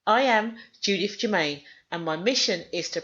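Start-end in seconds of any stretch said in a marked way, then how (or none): none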